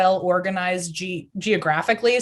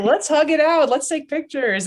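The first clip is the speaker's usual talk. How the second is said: excited; 8 semitones higher than usual. Retold or imitated. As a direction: gush like a social butterfly. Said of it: "I'm a butterfly".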